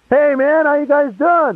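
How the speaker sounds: high-pitched, casual buddy greeting; hearty mock greeting. Walking in on old buddies, high hearty greeting. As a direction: call out high to old pals.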